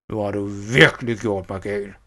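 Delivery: seething threat in a villain's voice; angry villain. A narrator's reading. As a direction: lean hard on "virkelig", furious. Enraged villain raises the knife.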